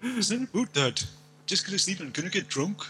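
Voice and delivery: mock Canadian pleading with Dad; Canadian accent. Shown as a demonstration. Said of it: "that's how they speak in Canada".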